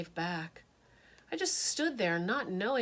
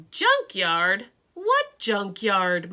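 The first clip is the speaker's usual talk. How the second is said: puzzled dad; 8 dB louder, much slower than usual. Acted.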